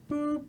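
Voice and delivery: high, soft electronic boop; imitated chime. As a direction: mimic a little announcement beep.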